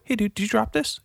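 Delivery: acting out a doorstep question; retold friendly query. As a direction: ask it high and casual. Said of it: "I was like".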